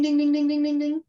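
high, bright bell-like ding ding; alarm ding. Retold or imitated. Mimicking an alarm going off.

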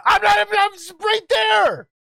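shouting. Real, not acted. Arguing over a definition.

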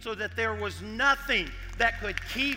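loud, building proclamation; full-force preaching. Real, not acted. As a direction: drive each phrase home to the congregation.

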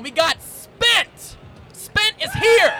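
slow, high guest call; announcer shout. Acted. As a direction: bring the guest on big. Introducing guest.

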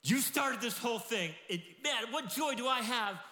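high, loud, eager pleading; five talent servant, thrilled and loud; excited servant. Retold or imitated. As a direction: burst out with joy to the master. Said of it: "he's like".

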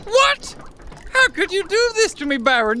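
high disbelieving cry; shocked, betrayed; shocked outrage. Acted. Betrayed villain cries out.

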